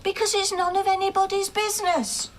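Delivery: high-pitched, indignant retort; defensive outburst. Acted. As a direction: snap it back, pitched well up. Defensive outburst in family argument.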